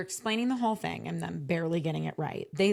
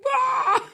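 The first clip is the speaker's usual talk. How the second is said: high frustrated shriek of disbelief; exasperated scream; 9 dB louder than usual. Retold or imitated. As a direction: let out a high exasperated cry. Exasperated scream at support reply.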